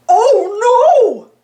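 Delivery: high, loud cartoon-dog cry of alarm; shouting. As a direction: friend racing toward a cliff. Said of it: "Goofy shouted".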